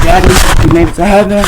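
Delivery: loud, heartfelt call to the sky; calling out to dad. Real, not acted. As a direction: call out to him like he can hear you.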